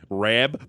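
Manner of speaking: imitated "Rab" for Rob, O turned to A; imitating Western New York accent on "Rab"; Western New York vowel. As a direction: mimic a Buffalo-area "Rab". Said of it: "she kept calling him Rab".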